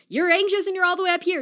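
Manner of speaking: high anxious sweep. Shown as a demonstration. Arms wide, anxious high up.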